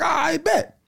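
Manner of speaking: high-pitched "got it" reaction; eager realisation. Retold or imitated. Learning he could go live with pictures, eager high-pitched agreement. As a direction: pitch it way up, seizing on the idea. Recalls his moment of realising.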